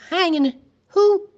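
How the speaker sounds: alarmed cry, high and loud; shocked. A narrator's reading. Teacher shocked the sheriff plans a hanging. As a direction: gasp out in alarm.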